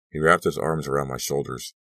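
plain reading voice

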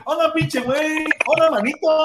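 playful imitation in a Mexican accent; mock Mexican greeting. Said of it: "hablando con su consorte allá".

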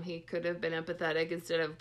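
ordinary delivery

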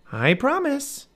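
put-on high, dutiful young girl; little girl voice. A narrator's reading. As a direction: promise in a high girl's voice. Narrator voices Annie.

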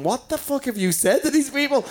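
high-pitched, incredulous imitation; mock bewilderment. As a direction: act out a man baffled by a whole crowd. Retold bewildered man facing chanting crowd.

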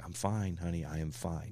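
low, hushed and placating; calm a spouse down very softly; retold soft reassurance. Retold calming of wife.

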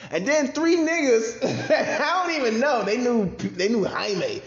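high, loud, laughing through the words; real laughter breaking into the sentence. Real, not acted. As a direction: crack up as you tell it. Cracks up while telling it.

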